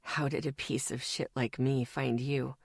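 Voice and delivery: low, very soft near-whisper; barely a whisper. Acted. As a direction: ask it in self-loathing, barely audible. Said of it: "his voice barely a whisper".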